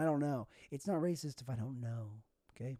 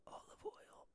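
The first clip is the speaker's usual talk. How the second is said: whispered aside; 21 dB quieter, slower than usual. Real, not acted. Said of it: "whispers the word".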